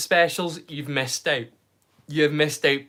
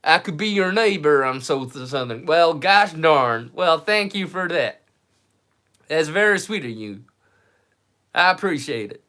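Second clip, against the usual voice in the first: put-on American Southern accent. Streamer thanking a viewer as a Southern neighbor, Southern accent. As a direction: play the grateful Southerner. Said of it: "You think I could pass as a southerner?".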